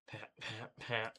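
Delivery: quiet gasping pants, spent; out of breath, collapsed against the wall; panting. Acted. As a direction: gasp for air, barely audible. Lying spent on ground.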